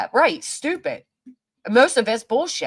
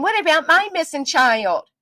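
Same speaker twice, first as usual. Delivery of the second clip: higher-pitched mock plea; mock-indignant quote, pitched up; mocking imitation; 5 semitones higher than usual. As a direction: imitate someone's plea with scorn. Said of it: "at the same time saying".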